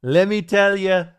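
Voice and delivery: loud Trump catchphrase; hammed-up Trump, punch tell; Trump impression. Trump starting his pitch to Bubba, loud. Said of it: "Trump tells Bubba".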